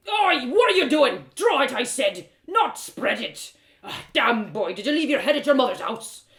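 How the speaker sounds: put-on loud, rough old smith barking at his apprentice; a burly smith losing patience, loud; gruff blacksmith, scolding.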